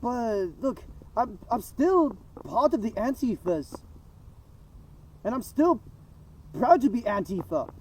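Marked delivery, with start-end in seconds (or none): none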